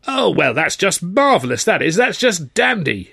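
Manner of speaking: high-pitched, exasperated mock delight; heavily sarcastic. A narrator's reading. Exasperated manager hears of the job offer, bitterly sarcastic. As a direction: praise it so it plainly means the opposite. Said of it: "a manner which quite definitely suggested that it was neither".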